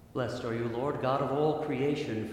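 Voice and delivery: usual speaking voice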